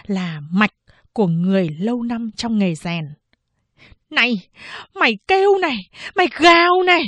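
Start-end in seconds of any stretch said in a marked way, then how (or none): 4.1-7.0 high, loud vicious scolding; angry yelling. A narrator's reading. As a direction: yell it at the child as a taunt. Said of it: "bà Lã vẫn cứ quát tháo". (quát tháo the thé)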